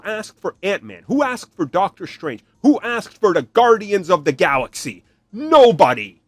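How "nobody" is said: loud, punched-out answer; a loud emphatic nobody. Real, not acted. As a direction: hammer the point home. Answering own rhetorical question.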